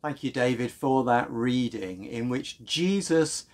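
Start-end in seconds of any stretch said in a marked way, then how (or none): none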